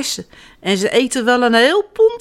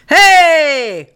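loud, high, drawn-out shout; 7 semitones higher, 8 dB louder than usual. A narrator's reading. Children scaring birds from the cherry tree, shouting. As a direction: yell it out to scare off birds. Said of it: "ze schreeuwen van".

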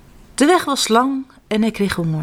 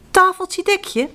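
magic spell; 11 semitones higher, slower than usual. Acted. Magic words of the table.

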